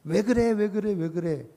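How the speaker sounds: rapid-fire repeated question, starting high; pressing, demanding. Retold or imitated. Parent badgering a baby who cannot understand, acted-out pressing. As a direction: imitate badgering, pitch easing down on each repeat. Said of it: "알아들을 수 없는 아이에게 다그치듯이".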